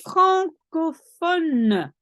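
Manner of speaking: teacher sounding out francophone for students; slow modelled word. Shown as a demonstration. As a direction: model francophone slowly, each syllable clear. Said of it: "And French speaking is".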